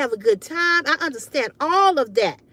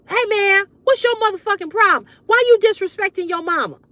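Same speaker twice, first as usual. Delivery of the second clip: higher, louder, fast scolding; scolding man; 7 semitones higher, 4 dB louder, faster than usual. Retold or imitated. Boyfriend scolding the son, fast and loud. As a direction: rip into the boy for disrespecting his mama.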